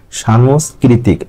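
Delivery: সাংস্কৃতিক said slowly with a long "sha" first syllable; slow, careful সাংস্কৃতিক starting "shan-"; teacher dictates the correct সাংস্কৃতিক; correct pronunciation. Shown as a demonstration. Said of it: "আপনি সাংস্কৃতিক বলতে পারবেন না".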